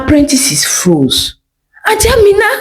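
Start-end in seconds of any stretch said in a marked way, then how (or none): 1.9-2.5 alarmed cry. Acted. Apprentices froze at her shaking hand.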